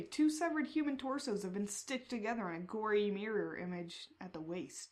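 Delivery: ordinary talk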